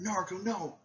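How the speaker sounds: high-pitched cry of protest; acted alarmed plea. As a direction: call out to stop a friend. Friends cry out to the hero.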